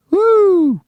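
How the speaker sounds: high loud woo; whoop. Real, not acted. Radio host cheering into the mic, high loud whoop. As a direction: let out a big excited whoop.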